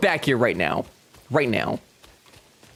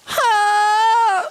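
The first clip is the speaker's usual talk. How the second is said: high singing wail; 5 dB louder than usual. Pretend. Singing climax cry.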